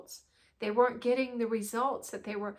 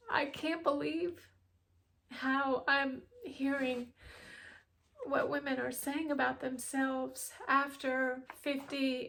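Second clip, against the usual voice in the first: getting emotional; 4 semitones higher than usual. Real, not acted. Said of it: "It makes me emotional".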